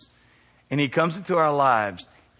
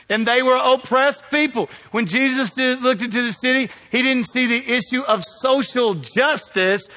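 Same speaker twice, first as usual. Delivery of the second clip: high, loud preaching, slowing at the end; preaching at full force; 8 semitones higher, 4 dB louder than usual. Acted. Pastor to the congregation about Jerusalem, fervent preaching. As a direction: drive each phrase home.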